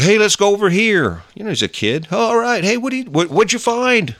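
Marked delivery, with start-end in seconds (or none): 0.0-1.2 put-on high, eager kid calling out; excited kid. Speaker voices a kid on an adventure, high and eager. 2.1-4.1 put-on high, eager kid calling out; excited kid. Speaker voices a kid on an adventure, high and eager. Voicing a kid finding something.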